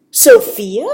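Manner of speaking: loud, questioning call. A narrator's reading. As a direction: call out to a friend in surprise. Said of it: "she called out".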